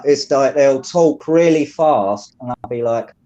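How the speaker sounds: ordinary talk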